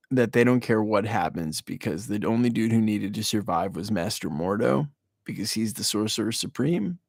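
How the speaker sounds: ordinary talk, nothing marked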